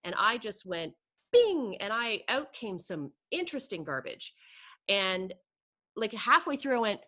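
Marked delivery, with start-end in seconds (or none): 1.3-1.8 high, loud "Bing" like a bell going off; imitating a triggered alarm ding; mock bing. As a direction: fire it off sharp and high. Retelling her instant reaction.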